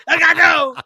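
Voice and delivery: very high, loud comic cry; mock battle cry. Storyteller playing the dive-bombing seagull scene, high hammed-up yell. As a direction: screech it out like a diving attacker.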